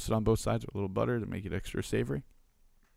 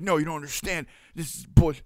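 imitating angry protest, high and getting louder; fired-up customer; 8 semitones higher, 6 dB louder than usual. Customer rejecting the owner's apology, fired up. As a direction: push the pitch up, building to a loud mock outburst. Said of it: "he's fired up".

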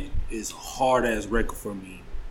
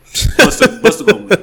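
laughing; 8 semitones higher, 7 dB louder than usual. Real, not acted. Laughing insistence in debate.

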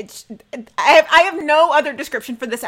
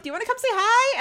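high sing-song invitation; playful high voice; 12 semitones higher, faster than usual. Retold or imitated. Actress inviting co-star to say hi, high playful voice. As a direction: chirp it up high and bright.

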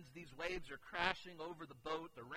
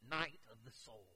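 normal talking voice

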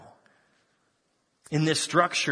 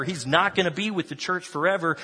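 ordinary talk, nothing marked

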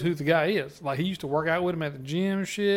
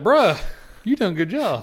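high, loud mock congratulation to the surgeon; hammed-up compliment on the surgery; mock praise; 5 semitones higher, 6 dB louder than usual. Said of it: "It's like".

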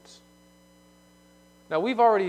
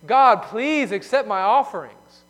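louder, slow, imitating a begging plea; mock pleading; 5 semitones higher, 9 dB louder, slower than usual. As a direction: play the whining worshipper, begging God. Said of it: "begging and crying and pleading".

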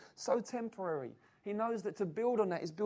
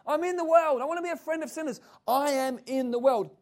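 higher, louder, hammed-up boast; 6 semitones higher, 8 dB louder than usual. Preacher mocking worldly Christians to his church, hammed-up higher and louder. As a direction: lampoon a believer who only cares about the world. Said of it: "So some Christians, this is what they emphasize".

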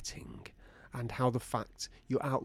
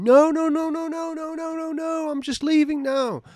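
high, loud stream of panicked protest; hammed-up frantic pleading; mock panic; 9 dB louder than usual. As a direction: blurt it out high like a driver caught getting a ticket. Car owner pleading with parking inspector.

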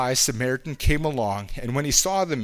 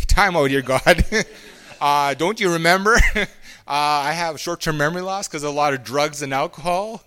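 laughing protest; 3 semitones higher, 6 dB louder than usual. Retold or imitated. Preacher retelling how he argued with God, loud and laughing. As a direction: throw up your hands and laugh it off loudly. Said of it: "I was like".

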